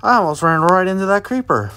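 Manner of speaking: fast, out of breath. Real, not acted. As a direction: talk while catching your breath. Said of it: "You can tell I'm out of breath now".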